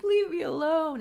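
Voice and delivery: high, loud, distressed protest; acted upset cry. Charlotte fleeing down an alley, crying out at Leif. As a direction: cry out to shake off a pursuer. Fleeing woman tells pursuer off.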